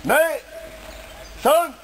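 shouted dojo commands; shouted drill count. Real, not acted. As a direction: call the count like a sensei. Count for the kiai.

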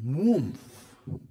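a sudden loud, breathy whoosh like flames bursting up; whoosh of fire. Retold or imitated. Preacher mimics a fireball hitting the platform with a whoosh. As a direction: burst out the sound of a fireball landing.

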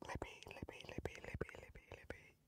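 rapid whispered chant of a name; whispered pleading. Real, not acted. Player praying for the vote result, urgent whisper. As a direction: whisper it over and over like a prayer.

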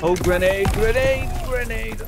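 urgent rising shout of grenade; grenade warning yell. Real, not acted. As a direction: yell the warning, pitch climbing. Grenade lands nearby in game.

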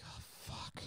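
unvoiced, soft realization; whispered dismay. Feeling sick at Wegmans, whispered dismay. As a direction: breathe it out as dread sinks in.

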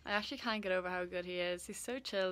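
ordinary delivery

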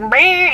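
high, loud buzzy "meh"; imitated poky-tool noise. Vlogger imitating the little pokey facial tool, buzzy noise. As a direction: mimic a little gadget whirring. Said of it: "pokey thing, like".

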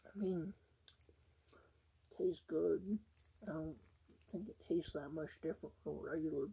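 talking between bites; chewing food while speaking; mouth full. Real, not acted. Seen 0: burger at mouth, bites between phrases; mouth often hidden.

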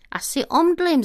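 a frightened call of someone about to faint. Retold or imitated. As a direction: call out that you might faint. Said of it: "zavolala jsem".

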